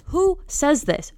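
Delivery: slow, high-pitched disbelief; incredulous. Real, not acted. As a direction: draw out the outraged question. Outraged at the mother's texts.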